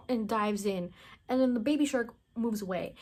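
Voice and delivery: usual speaking voice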